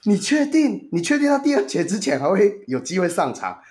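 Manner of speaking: 高八度的夸张质疑; 拔高嗓门，不敢相信地连声追问; 难以置信. Retold or imitated. 转述投票时心里的难以置信. (用又高又惊讶的语气质问)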